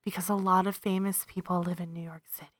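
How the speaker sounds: whisper. Real, not acted. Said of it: "hushed gossip about famous people".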